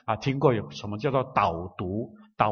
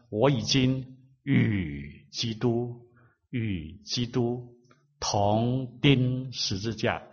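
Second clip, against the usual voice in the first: very slow, softer, each phrase drawn out; slow reading; 3 dB quieter, much slower than usual. Shown as a demonstration. Preacher demonstrates slow reading of the verse, very slow. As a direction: savour the verse, word by word. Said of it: "你慢慢的读".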